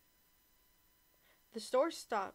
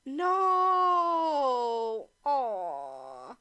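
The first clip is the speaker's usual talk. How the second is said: anguished cry; 4 semitones higher, 10 dB louder than usual. Real, not acted. Sudden shocked cry at scene.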